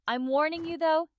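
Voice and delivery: ordinary talk, nothing marked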